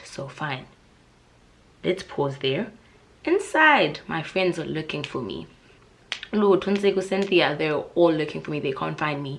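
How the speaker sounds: usual speaking voice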